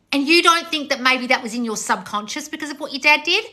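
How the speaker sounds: ordinary talk, nothing marked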